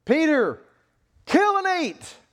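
high, loud voice from heaven; commanding shout. Acted. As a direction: call out and order him, full force. God orders Peter.

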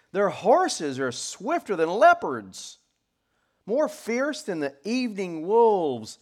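loud, high, measured proclamation; forceful pulpit reading; declaimed scripture. Acted. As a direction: ring out each fearsome trait.

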